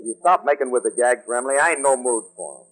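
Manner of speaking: low-pitched, grumpy brush-off; morose. Acted. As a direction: glum and irritable, in a low register. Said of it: "Are you morose".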